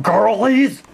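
ordinary talk, nothing marked

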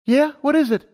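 put-on high, eager young mare; Lumina's voice. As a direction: voice her up high, keen and bright-eyed. Lumina answers.

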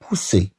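بوسي with a crisp unvoiced S in the middle; بوسي, middle consonant a hissed S; correct S sound. Shown as a demonstration. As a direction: say بوسي as French "poussé", S not Z. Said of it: "لأن فعل دفع".

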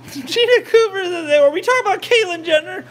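high, loud, laughing question; amused squeal through laughter. Real, not acted. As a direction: break into a high-pitched laugh while talking. Amused reaction to a viewer comment.

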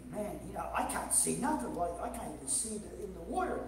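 mock glum complaint. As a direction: play a weary fisherman muttering low. Acted complaint of half-blind fisherman.

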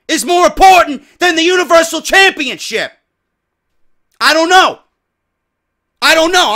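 loud, high, exasperated yelling; high, loud outraged rant; furious rant. Real, not acted. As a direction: blow up at the booking. Angry rant at the card.

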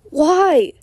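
high, loud anguished cry; desperate yell. A narrator's reading. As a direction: yell it in despair. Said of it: "he yells, desperately".

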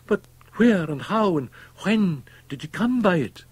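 high, loud outcry; urgent, high-pitched demand; crying out. A narrator's reading. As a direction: cry out in alarmed disbelief. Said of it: "cried the other".

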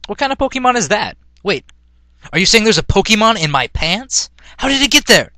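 rising into a high, loud yell; alarmed yell. A narrator's reading. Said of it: "Ash yelled".

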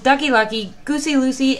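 plain reading voice

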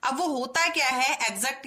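ordinary talk

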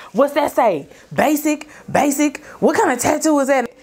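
high, taunting sneer; mocking cousin. Cousin taunting Bossy about the tattoo, mocking. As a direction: tease her about a tattoo.

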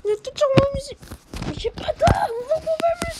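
crié bien plus haut et plus fort, frustré; cri énervé. Real, not acted. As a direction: hurler sur les ennemis, à bout. Rage en jeu, sans arme. (cri de rage face aux ennemis)

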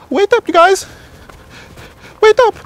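calling out. Acted. Luigi to guys walking ahead, calling out. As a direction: holler after the group ahead.

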